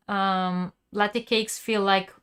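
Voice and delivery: ordinary talk, nothing marked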